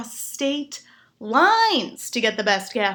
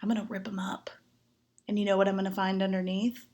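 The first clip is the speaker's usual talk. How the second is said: much softer low bedroom voice; seductive murmur; 3 semitones lower, 6 dB quieter than usual. Retold or imitated. The gentleman teasing about the carpets, a soft seductive murmur. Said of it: "He's like".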